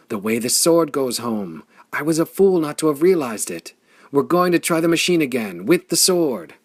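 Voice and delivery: high, loud eager rush; loud, elated discovery; excited. A narrator's reading. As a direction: blurt out the plan in a thrill.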